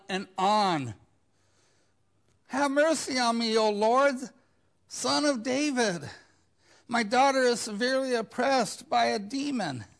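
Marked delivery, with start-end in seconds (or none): 2.5-9.9 loud, high desperate plea; mock anguished pleading; pleading cry. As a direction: beg for mercy out loud. Voicing the crying woman.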